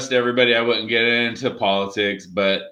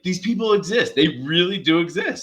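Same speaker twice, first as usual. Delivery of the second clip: raised pitch, exasperated; high exasperated mock; 6 semitones higher than usual. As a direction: squeak it out in disbelief.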